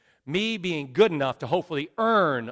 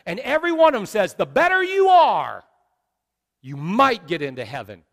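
loud, high preaching cry; preaching at full force; 4 semitones higher, 7 dB louder than usual. Real, not acted. Preacher sums up what other religions say, full-force preaching. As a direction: climb in pitch and volume to the last phrase.

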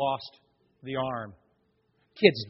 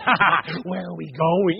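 mock grim reaper; 2 semitones higher, 6 dB louder than usual. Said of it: "here's the Grim Reaper going".

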